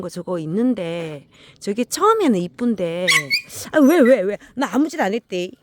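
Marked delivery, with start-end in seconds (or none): 3.7-5.5 loud, high startled protest; loud high protest at a barking dog; startled reply to the dog. Real, not acted. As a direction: answer the barking dog loudly and higher. Dog barks, she reacts.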